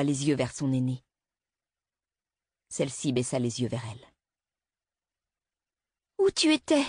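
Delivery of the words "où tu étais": put-on high, small child asking; a little girl's frightened question; child's voice. A narrator's reading. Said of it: "demanda la petite fille". (voix de petite fille inquiète)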